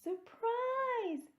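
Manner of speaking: very high, loud gleeful yell; shout. A narrator's reading. Amelia Bedelia greeting the guest of honour, shouting. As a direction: burst out with it, full voice. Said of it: "shouted Amelia Bedelia".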